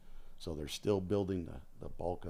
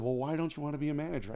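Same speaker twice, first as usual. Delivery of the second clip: quick, higher mock boss question; mock incredulous bosses; 8 semitones higher, 5 dB louder, faster than usual. Retelling bosses pushing him to manage, higher and quick. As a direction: play the baffled boss, fast and high. Retold bosses ask, raised voice.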